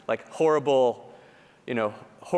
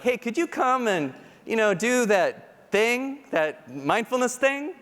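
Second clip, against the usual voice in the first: coaxing request; 9 semitones higher, slower than usual. Retold or imitated. Colleagues asking at offsite.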